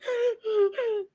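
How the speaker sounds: high teary gasp, mocking; hammed-up weepy gasp; mock crying gasp. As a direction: fake a sobbing intake of breath. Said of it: "while, like".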